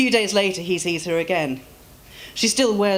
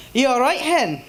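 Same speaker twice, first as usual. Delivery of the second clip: kindly Scottish voice, higher and louder; concerned Scottish man; 8 semitones higher, 4 dB louder than usual. Acted. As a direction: check on the fallen woman like a gruff kind Scot.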